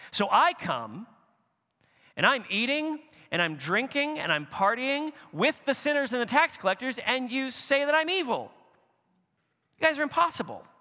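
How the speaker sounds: high, loud, incredulous protest; exasperated Jesus. Acted. Jesus scolding a generation that rejects him, exasperated and loud. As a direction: throw up your hands at impossible critics. Jesus mocking critics' double standard.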